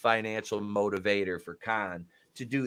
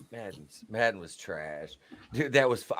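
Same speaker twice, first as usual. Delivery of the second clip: usual speaking voice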